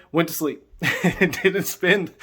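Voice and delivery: laughing through the words; amused laughing. Real, not acted. Man recalling his quick prayer, amused laughing. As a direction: let a chuckle break into the telling.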